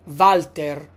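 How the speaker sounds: "Walter" with a V, VAL-ter; "Walter" starting on a V sound; "Walter" said VAL-tair; Italian Walter. Shown as a demonstration. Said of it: "we say Walter in Italian".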